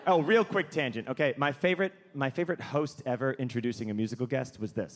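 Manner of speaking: usual speaking voice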